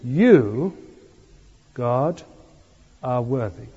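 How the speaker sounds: "You" long and strong, then a pause, "are worthy" falling away; stress on YOU. Shown as a demonstration. Preacher showing the congregation another emphasis in worship, stress on "You". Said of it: "put the emphasis on you".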